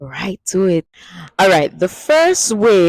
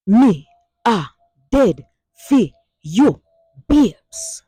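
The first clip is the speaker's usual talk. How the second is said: "Me a", "dead", "for you", "babes" split by pauses, higher and louder; dramatic, word by word; 5 semitones higher, 4 dB louder, slower than usual. Shown as a demonstration. Teacher breaking down the dramatic line for the class. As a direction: spell it out dramatically, "babes" high. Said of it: "Hear that?".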